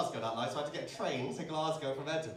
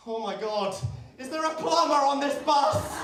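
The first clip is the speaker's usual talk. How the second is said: high, loud, slow mock-panic shout; hammed-up desperate plea; melodramatic call-out; 10 semitones higher, 11 dB louder than usual. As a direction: bellow it down the bus like a film emergency. Driver calls out like film emergency.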